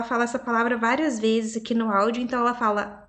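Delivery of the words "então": normal talking voice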